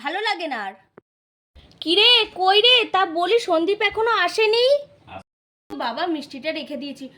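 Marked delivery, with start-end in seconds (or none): 1.8-4.7 loud, raised call across the house. Acted. Sister calls out to ask whether Sandip has come, calling out. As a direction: call out loudly, wanting an answer. Calling out about late brother.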